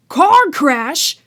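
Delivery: high, loud roaring giant. Acted. Hagrid roaring at the Dursleys in outrage. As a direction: roar it in outrage. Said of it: "roared Hagrid".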